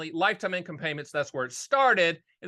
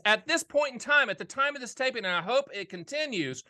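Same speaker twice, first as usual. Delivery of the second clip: normal talking voice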